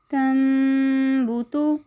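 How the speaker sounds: तंबुतु recited very slowly, each sound stretched; slow drawn-out recitation. Shown as a demonstration. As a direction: chant तंबुतु syllable by syllable, slowly. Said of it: "हिज्जे करने हों". (धीमी तजवीद वाली क़िराअत)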